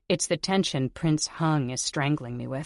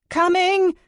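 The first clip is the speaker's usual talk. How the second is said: much higher and louder, a breathless yell; breathless scream; 10 dB louder than usual. Acted. Heroine screaming to the maids at the door, breathless. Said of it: "I scream again, this time a little more breathless".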